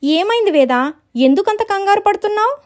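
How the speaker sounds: very high, rising question; acted concerned questioning. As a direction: ask the panicking sister what is wrong. Sister asks why she panics.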